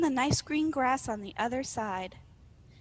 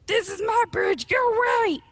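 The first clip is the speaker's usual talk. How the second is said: loud, raised troll yell; troll shout; 11 semitones higher, 6 dB louder than usual. Acted. Arg orders the rival troll off his bridge, loud shout. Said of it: "he shouted".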